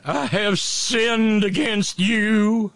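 very slow, high, loud wailing confession; televangelist bawling. Retold or imitated. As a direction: wail it drawn out like a weeping TV preacher. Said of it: "there's the picture of Jimmy Swaggart".

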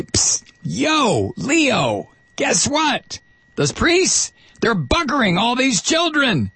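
high, hammed-up urgent tip-off; high-voiced mock informant spilling a scandal; mock tip-off to a pope. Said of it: "whisper into one of these".

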